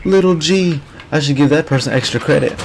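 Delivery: a hint of a scoffing teacher, high and fast; lightly voiced sarcastic teacher; mock teacher. Retelling the teacher mocking a student, high sarcastic voice. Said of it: "and he was like".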